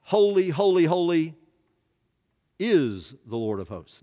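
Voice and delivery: loud, high Holy, holy then settling; proclaiming. Shown as a demonstration. Preacher quoting the angels in Isaiah, loud and proclaiming. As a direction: ring out the seraphim's cry. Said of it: "Isaiah chapter six".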